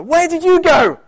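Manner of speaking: put-on high, loud wounded child accusing parent; mock-upset kid scolding the parent for leaving. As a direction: whine like an upset child demanding answers. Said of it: "as the kids get older".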